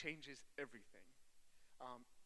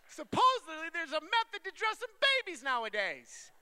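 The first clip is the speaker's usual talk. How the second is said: high, very loud comic rant; shouted protest of a baffled dad; exasperated shouting; 20 dB louder than usual. Acted. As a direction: yell it at full volume, indignant and high-pitched. Comic rant about dressing babies.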